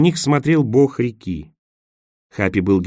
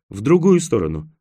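building louder and higher in excitement; excited urging; 5 semitones higher, 4 dB louder than usual. A narrator's reading. Roen telling him which way to turn, excited. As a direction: urge it eagerly, stronger on the repeat. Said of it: "возбуждённо напомнил Роэн".

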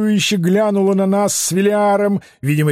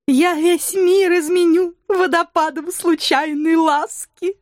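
very high, joyful sing-song; happy announcement; 11 semitones higher than usual. Acted. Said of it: "счастливо оповестила прихорошившаяся банши".

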